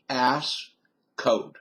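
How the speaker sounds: "Ice code" drawled slow, the "cold" softened to "code"; Southern "Ice code". Shown as a demonstration. Quizzing Yankee viewers, saying "Ice code" in a Southern drawl. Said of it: "that's Southern for ice cold".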